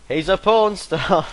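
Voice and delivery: called out high, loud and slow; imitating a flatmate yelling it across the room; loud blurt.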